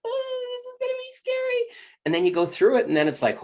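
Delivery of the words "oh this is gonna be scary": put-on very high, small child voice; a frightened little kid inside; scared inner child. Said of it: "a little girl or a little boy inside".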